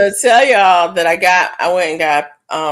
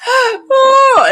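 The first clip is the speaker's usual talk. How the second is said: high squealing laugh; laughing hard; 5 dB louder than usual. Real, not acted. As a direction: laugh till it almost cries. Laughing at her own joke.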